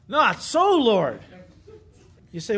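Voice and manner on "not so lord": "Not so, Lord" loud, with "so" leaping high; acted, forceful refusal on "Not so, Lord"; emphatic. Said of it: "In the Greek, it's emphatic".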